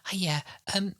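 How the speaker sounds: high-pitched tentative offer; imitating polite staffer. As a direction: mimic someone politely interrupting. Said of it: "someone coming and tapping us on the shoulder and going".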